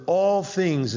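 "all things" pitched high and loud, leaning on "all"; "all things" set apart and pushed up as the point of the verse; emphatic all things. Shown as a demonstration. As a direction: hit "all things" hard as the key words. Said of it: "is emphatic here".

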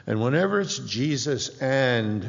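ordinary talk

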